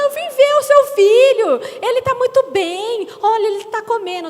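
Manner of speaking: high, loud, gushing reassurance; sugary churchwoman. Retold or imitated. Churchwoman reassuring the mother about her son, gushing. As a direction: gush over the baby to calm mum.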